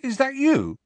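very high, incredulous question; pitched right up in disbelief; disbelieving. A narrator's reading. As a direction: ask it as if you can hardly believe it. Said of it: "he said disbelievingly".

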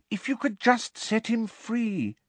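put-on high, hopeful girl voice; gently suggest, hoping. A narrator's reading. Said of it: "said Hermione hopefully".

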